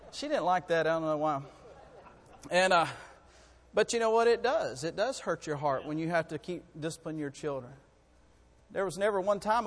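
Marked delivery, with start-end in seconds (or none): none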